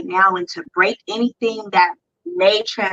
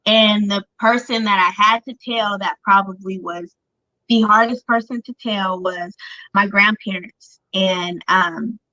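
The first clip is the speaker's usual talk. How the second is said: normal talking voice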